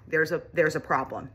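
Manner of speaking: normal talking voice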